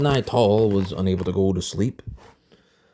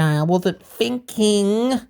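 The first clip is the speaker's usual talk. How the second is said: mother's line; 11 semitones higher, much slower than usual. A narrator's reading. Mother's line, high and slow.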